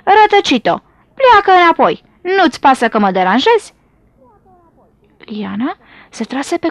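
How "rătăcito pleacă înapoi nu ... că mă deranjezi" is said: high, forceful telling-off; acted annoyance, sharp and raised; angry snake scolding. A narrator's reading. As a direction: send the intruder packing, pitched up. Snake orders the intruder away.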